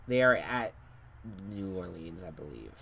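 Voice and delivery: ordinary delivery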